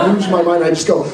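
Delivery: usual speaking voice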